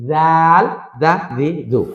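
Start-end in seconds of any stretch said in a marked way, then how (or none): 0.1-0.6 জাল with a long, held vowel; drawn-out letter name. Shown as a demonstration. Teacher naming a letter for learners, drawn out. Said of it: "টেনে বলতে হবে".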